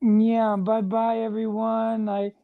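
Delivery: ordinary delivery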